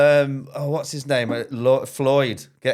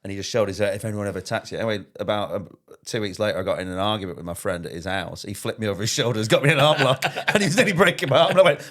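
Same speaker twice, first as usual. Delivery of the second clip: usual speaking voice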